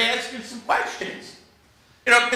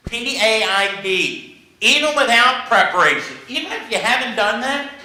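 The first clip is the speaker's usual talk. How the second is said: ordinary talk, nothing marked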